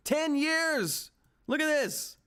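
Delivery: can't believe it, pointing at the chart; excited disbelief. Real, not acted. Stock price jumping live on stream.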